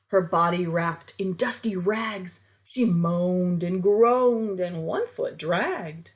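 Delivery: ordinary delivery, nothing marked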